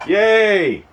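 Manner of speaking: high bright yay; excited cheer. Pretend. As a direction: cheer it like a kid. Mock cheer for good tank.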